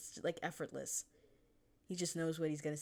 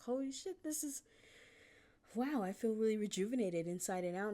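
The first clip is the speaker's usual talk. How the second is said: amazed bather; 6 semitones higher than usual. Pretend. Anybody gets in water, amazed.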